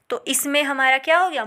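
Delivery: ordinary delivery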